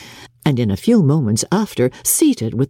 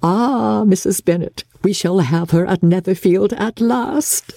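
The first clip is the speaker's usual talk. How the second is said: higher, lightly voiced gossiping neighbour; mimicking Mrs Long; 5 semitones higher than usual. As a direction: imitate the flattering neighbour. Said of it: "what do you think she said besides".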